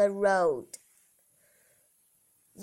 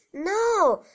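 squeaky little pig; 10 semitones higher, 3 dB louder than usual. Acted. Little pig refuses wolf.

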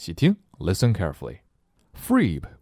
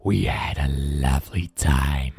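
slow, much lower happy monster voice; smiling monster; 7 semitones lower than usual. Acted. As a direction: beam it out like a contented beast. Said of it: "smiled Blob".

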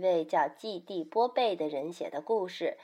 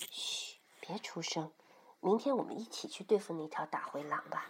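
whispered bedtime hush; hushed soothing; 3 semitones lower, 10 dB quieter than usual. Acted. Mother hushes the child in bed, whispering. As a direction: shush softly, then whisper on.

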